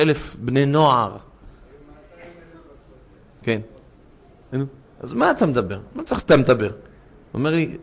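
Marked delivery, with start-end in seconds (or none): none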